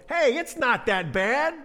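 high, loud, drawn-out glib comfort; hammed-up attempt to cheer someone up; mock consolation. Elkanah responding to his wife, mock consolation. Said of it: "he responds with, with, with the old".

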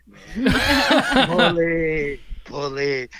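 giggling through the name; laughing. Real, not acted. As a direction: laugh it out, amused. Laughing at the nickname.

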